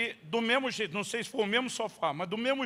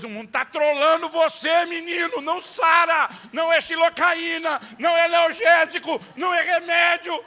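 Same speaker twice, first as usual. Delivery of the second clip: higher, much louder ranting shout; wanting to shout; 10 semitones higher, 12 dB louder than usual. Retold or imitated. Father shouting at his son that kisses don't heal. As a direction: rant at the top of your lungs. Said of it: "Eu quero gritar pra Deus".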